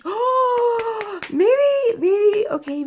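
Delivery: very high, hammed-up exclamation; acted squeal. Vlogger acting out her heroine worship, mock squeal. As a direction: squeal it out theatrically, then ease down. Acts out dramatic exclamation, then concedes.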